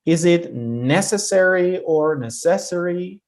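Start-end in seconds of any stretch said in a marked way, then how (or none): 0.5-1.8 NECessary, punched on NEC; stress on first syllable. Shown as a demonstration. Teacher quizzing students on a word, first-syllable stress on necessary. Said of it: "How do we pronounce the first one". 2.1-3.2 neCESsary, stress shifted onto CES; stress on second syllable. Shown as a demonstration. Teacher offering the wrong option, second-syllable stress on necessary. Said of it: "have to put the stress".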